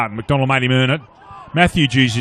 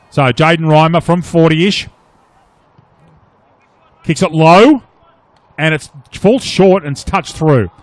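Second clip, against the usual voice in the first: excited commentary; 2 semitones higher, 6 dB louder than usual. Real, not acted. Live call of a goal kick.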